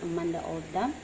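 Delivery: normal talking voice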